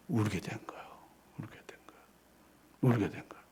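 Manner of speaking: breathless whisper, almost silent. Real, not acted. Pastor telling how Peter wept, whispered. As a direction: let it fade into a whisper.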